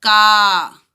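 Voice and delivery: का with a long, open aa. Shown as a demonstration. Teacher to children, का with a long stretched aa. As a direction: stretch the aa in का clearly. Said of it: "अब इसको हम कैसे पढ़ेंगे?".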